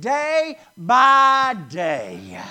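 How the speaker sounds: slow, every word "day by day" drawn out; stress on day by day. Shown as a demonstration. Preacher drawing out "day by day" for the congregation. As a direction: lean hard on "day by day", slowly. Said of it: "And notice it".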